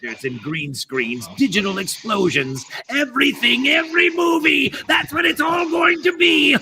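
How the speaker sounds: a gleeful mad scientist working himself into a frenzy; building villain rant. Acted. Voice actor playing a mad scientist who rants about superhero movies.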